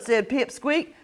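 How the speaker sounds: plain reading voice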